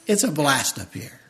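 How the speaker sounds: ordinary delivery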